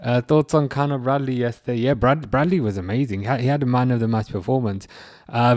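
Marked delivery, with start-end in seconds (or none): none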